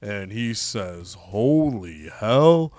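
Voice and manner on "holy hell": slow, lower tough-guy voice tagging Chico; slow cocky promo, dropping the pitch on each Chico; Razor Ramon. Retold or imitated. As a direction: lean back and swagger every line, slowly. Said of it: "Razor Ramon comes out to the ring and he says".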